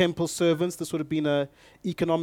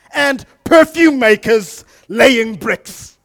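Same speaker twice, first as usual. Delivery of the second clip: loud, high preacher's voice; fired-up sermon delivery; full-force preaching; 9 semitones higher, 12 dB louder than usual. Acted. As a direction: preach it out to the whole room.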